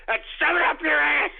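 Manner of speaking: ordinary delivery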